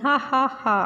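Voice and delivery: a lightly voiced donkey's ha ha ha; storyteller giving the donkey a small mock laugh. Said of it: "donkey is laughing".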